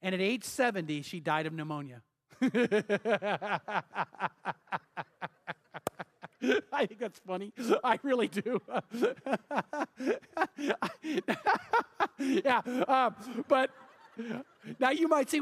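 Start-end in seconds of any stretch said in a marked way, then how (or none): 2.4-5.3 a warm real chuckle at the punchline; hearty laugh. Real, not acted. Preacher laughing at his own story. 8.6-12.1 rolling real laughter, a little higher; long laugh. Real, not acted. Preacher still laughing at the pneumonia joke.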